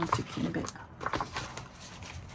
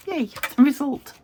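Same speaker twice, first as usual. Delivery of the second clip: bright little cheer, higher and louder; excited cheer; 7 semitones higher, 8 dB louder than usual. Real, not acted. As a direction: celebrate the result. Small celebration.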